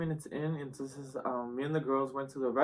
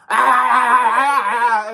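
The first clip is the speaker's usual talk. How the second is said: high, loud chanted shouts; loud laughing shout; mock battle cry; 8 semitones higher, 12 dB louder, much slower than usual. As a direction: yell it like a hype chant. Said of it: "and then I'm like".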